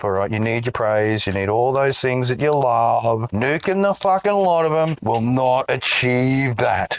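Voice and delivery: ordinary talk, nothing marked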